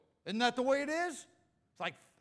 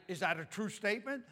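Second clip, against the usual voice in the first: normal talking voice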